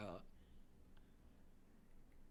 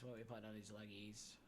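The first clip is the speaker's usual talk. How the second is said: ordinary delivery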